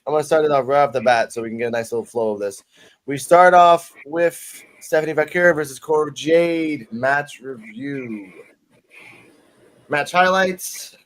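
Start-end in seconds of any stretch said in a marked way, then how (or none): 3.1-11.0 slow, drawn-out segment intro; slow announce. Acted.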